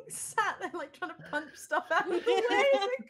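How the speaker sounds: high, breathless laughing squeak; can barely get it out for laughing; laughing through words. Real, not acted. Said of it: "Love the idea".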